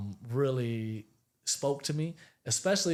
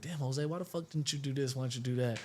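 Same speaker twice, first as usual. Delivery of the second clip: scolding himself; 7 dB quieter than usual. Retold or imitated.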